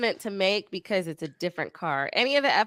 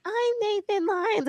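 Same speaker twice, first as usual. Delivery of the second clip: put-on high, slow little kid voice; squeaky young kid imitation; 12 semitones higher, 3 dB quieter, slower than usual. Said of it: "his voice was like".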